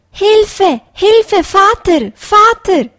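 put-on high, loud child's calls for father; desperate cries for help. A narrator's reading. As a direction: shout for help like a lost little hare. Lost child calls from woods. (hohes, lautes Hilferufen eines Kindes)

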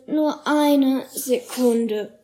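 very slow, worn-out murmur; exhausted murmur. A narrator's reading. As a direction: drag out each word, spent. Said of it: "sinkt zu Boden und murmelt".

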